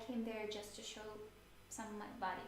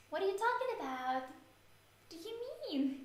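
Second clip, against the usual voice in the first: baffled; 8 semitones higher than usual. Real, not acted. Baffled by chat question.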